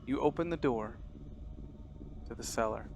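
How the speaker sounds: measured, hushed horror narrator; quiet, deliberate game master storytelling; scene narration. Acted. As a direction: set an eerie scene with long pauses.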